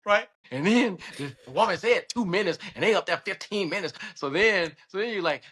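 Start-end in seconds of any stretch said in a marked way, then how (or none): none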